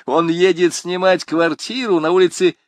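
high, loud jeering greetings; student jeering at the passing rector; mocking jeers. A narrator's reading. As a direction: heckle the rector with glee. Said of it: "воскликнул Жан Мельник".

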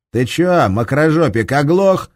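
loud, high angry rebuke; angry exhale. Acted. Seryoga rebukes Shams, angry and loud. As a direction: hurl it at him in anger. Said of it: "выдохнул Серёга".

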